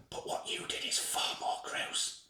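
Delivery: leaning in close, whispered threat; soft menacing whisper. Acted. Host leaning close to the boy, whispering. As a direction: whisper it right in his face. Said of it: "contorts his face and softly says".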